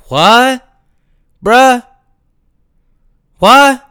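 loud, high outraged "what?"; shouting in disbelief at the news; incredulous shout. Real, not acted. Vlogger reacting to celebrity couple news, shouting in disbelief.

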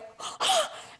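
big mock gasp of wonder; awed gasp. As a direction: gasp like a starstruck kid. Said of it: "one little girl was like".

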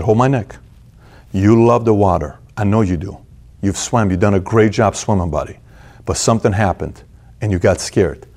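low, gentle encouragement; soothing father. Retold or imitated. Father soothing crying son in pool.

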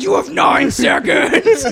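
hammed-up screechy ultimatum; retold threat. Guest warning clerk at the door, slow high threat. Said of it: "I was like".

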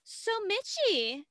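put-on high, slow mean-girl drawl; sly Tess. Tess baiting Mitchie, high sly mock voice. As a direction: tease her sweetly and slowly. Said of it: "Tess is like".

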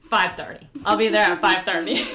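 loud correction. Retold or imitated. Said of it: "I was like".

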